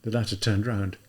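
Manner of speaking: usual speaking voice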